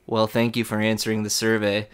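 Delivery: mock nonchalant phone rep. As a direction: say it dead calm, no reaction. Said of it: "Just so nonchalant".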